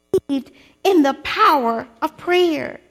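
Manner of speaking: usual speaking voice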